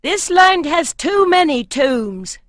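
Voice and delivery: a wary warrior grumbling loudly; uneasy warrior. A narrator's reading. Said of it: "came the second voice again".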